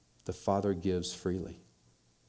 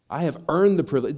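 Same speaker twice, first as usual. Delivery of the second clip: loud, higher, stressing earned; indignant elder son; 5 semitones higher, 8 dB louder than usual. Retold or imitated. Preacher echoing the son's claim, loud indignant. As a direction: hit earned like a grievance. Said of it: "his mindset is very clear here".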